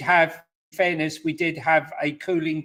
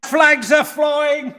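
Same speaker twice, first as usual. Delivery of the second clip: gloating shout; 9 semitones higher, 7 dB louder, much slower than usual. Acted. Gloating over a trophy.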